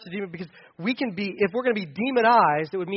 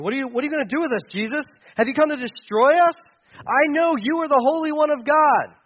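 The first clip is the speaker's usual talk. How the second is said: frightened demon; 7 semitones higher, 4 dB louder than usual. Acted. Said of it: "I'll read it to you".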